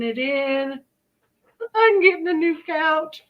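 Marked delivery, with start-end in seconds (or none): 1.7-3.2 excited. Real, not acted. Delighted about the bargain couch.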